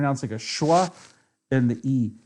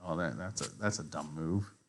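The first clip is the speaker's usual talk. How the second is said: much lower and softer, deflated aside; quiet dismay; 10 semitones lower, 14 dB quieter than usual. Real, not acted. Streamer losing a chess game, quiet deflated mutter. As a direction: mutter it to yourself, crestfallen. Sees opponent's move.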